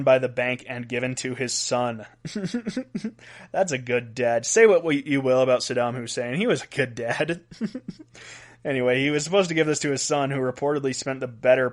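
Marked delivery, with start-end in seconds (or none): 2.1-3.1 soft, high giggle; amused giggle. Real, not acted. Host amused at the gift to the son, soft giggle. 7.5-8.0 soft, high little snicker; amused snicker. Real, not acted. Host joking that Saddam was a good dad, soft snicker.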